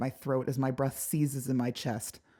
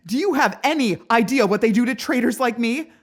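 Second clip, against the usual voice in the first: frantic, high and loud; desperate fear spilling out; panicked; 9 semitones higher, 11 dB louder than usual. Acted. Panicked outburst at the prince.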